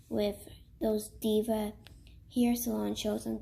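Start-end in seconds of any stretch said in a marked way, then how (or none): none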